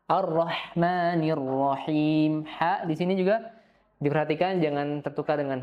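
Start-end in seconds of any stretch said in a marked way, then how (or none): 0.1-2.4 very slow chanted verse; Quran recitation. Shown as a demonstration. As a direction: intone the whole verse slowly.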